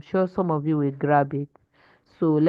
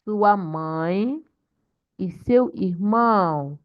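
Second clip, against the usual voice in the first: slow, word-by-word Portuguese with stretched stressed syllables; model reading; 3 semitones higher, slower than usual. Shown as a demonstration. Teacher showing class how to read correctly, slow model reading. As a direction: read it out deliberately, lifting phrase ends. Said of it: "That's how to read correctly in Portuguese".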